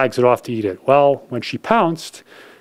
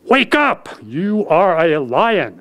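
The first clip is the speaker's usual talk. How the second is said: lion rousing; 6 semitones higher, slower than usual. A narrator's reading. Lion rouses the cub.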